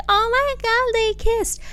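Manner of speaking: high squealing gossip voice; gossipy onlooker. Retold or imitated. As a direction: squeal like a shocked girl at a party. Mimicking onlookers gasping at kiss.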